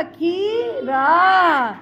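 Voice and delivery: खीरा stretched very slowly with a falling end; drawn-out word. Shown as a demonstration. Teacher blending खी and रा into खीरा, very slow. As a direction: spell out खीरा slowly for the class. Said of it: "क्या बन गया?".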